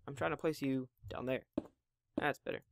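usual speaking voice

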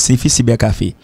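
usual speaking voice

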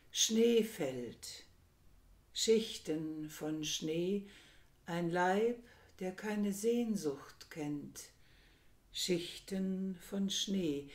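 slow, measured poem reading with long pauses; poem recitation. Acted. Lecturer opens by reciting a Korean poem, slow and measured. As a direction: let each short phrase stand alone, unhurried.

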